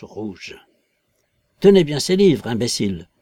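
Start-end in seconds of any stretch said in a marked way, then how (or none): 1.6-3.1 rough. A narrator's reading. Invisible man scolding his clumsy porter, rough. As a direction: order him about roughly. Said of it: "fit la voix avec rudesse".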